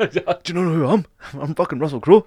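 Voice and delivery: mock-outraged star, high and loud; Russell Crowe. Imitating Russell Crowe confronting a man in a bathroom, mock outrage. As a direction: bluster with hammed-up self-importance.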